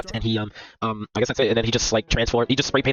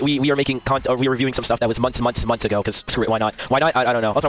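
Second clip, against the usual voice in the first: normal talking voice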